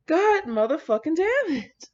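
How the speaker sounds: usual speaking voice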